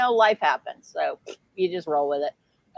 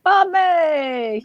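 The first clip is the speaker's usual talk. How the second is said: high, loud cheer of the name; excited announcement; 9 semitones higher, 6 dB louder than usual. Real, not acted. Host cheers the giveaway winner, high and loud. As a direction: call out the winner with delight.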